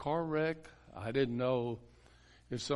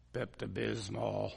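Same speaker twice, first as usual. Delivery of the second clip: low, odd demon voice; a hint of a possessed woman answering; lightly voiced demon; 4 semitones lower than usual. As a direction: strange low voice answering the pastor. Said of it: "she said".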